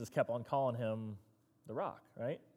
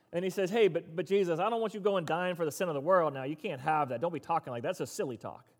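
high, loud, fired-up preaching; preaching at full force; 6 semitones higher, 8 dB louder than usual. Acted. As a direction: drive it home to the congregation with full energy.

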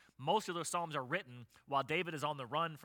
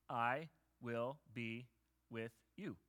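reassuring God; 4 semitones lower, 6 dB quieter, much slower than usual. Acted. God reassures timid Gideon.

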